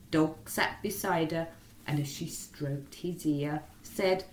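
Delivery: ordinary delivery, nothing marked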